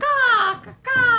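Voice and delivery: loud high crow cawing. Pretend. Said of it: "I don't know what this is".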